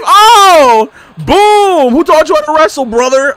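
hyped yelling. Real, not acted. Gamer hyped by a big wrestling move, loud excited shouting. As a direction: shout it like a ringside fan losing it.

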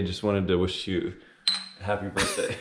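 ordinary talk, nothing marked

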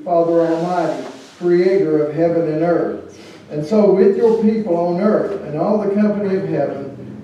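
loud, formal liturgy voice; liturgical leading. Acted. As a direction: lead the congregation's confession and prayer. Leads communion liturgy, sung parts.

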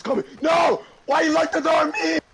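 high frantic yell; panicked shout. Real, not acted. Locked out, monster coming.

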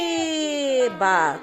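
РИБА with a long stressed РИИ, short БА; calling the word out. Shown as a demonstration. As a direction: call out РИБА, holding the first syllable. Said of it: "А тепер поклич". (протяжно кличе: «РИИба»)

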